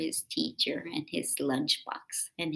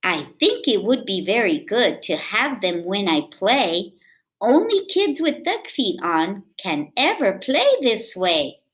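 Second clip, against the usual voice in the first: put-on lively read-aloud for kids; storybook reading; 5 semitones higher, 11 dB louder than usual. A narrator's reading. Reading a picture book aloud to children, lively.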